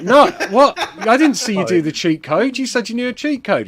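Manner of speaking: high, loud, disbelieving; acted indignant pleading; baffled protest. Narrator protests in acted quarrel.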